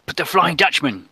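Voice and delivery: high-pitched protesting sailor voice; anxious crewman. Acted. Crewman protesting to a musketeer, anxious high voice.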